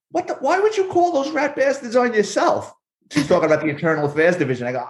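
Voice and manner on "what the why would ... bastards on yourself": high, hammed-up outraged old captain; mock the captain's disbelief, pitched up high; angry captain. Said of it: "and he's like".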